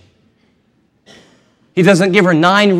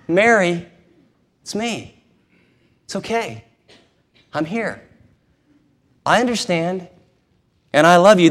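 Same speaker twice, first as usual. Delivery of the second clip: tender comfort. Acted. Jesus comforting Mary tenderly.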